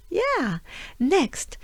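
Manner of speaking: ordinary talk, nothing marked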